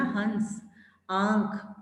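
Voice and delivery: हंस and आंख with the vowel sent through the nose; teacher modelling chandrabindu nasal sound; nasal vowel demo. Shown as a demonstration. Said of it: "इसको practice करके देखना".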